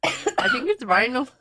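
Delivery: mock German accent on vinyl. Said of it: "I like it German".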